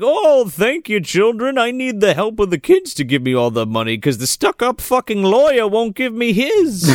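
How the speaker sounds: hammed-up Santa gratitude turning peevish; grateful Santa. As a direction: gush thanks, then gripe at the end. Acted Santa thanking, then griping.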